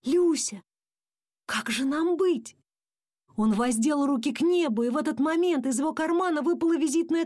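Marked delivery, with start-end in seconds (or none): 0.0-2.6 high, pleading call; crying out. A narrator's reading. Dad asking his wife what to do, crying out. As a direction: appeal to her, pitch raised. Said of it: "вскричал папа".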